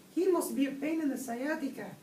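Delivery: high-pitched squeaky kitten; kitten hero voice. A narrator's reading. Skipito chimes in on the Bobolito, high kitten voice. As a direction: pipe up in a high little voice. Kitten replies to Don Diego.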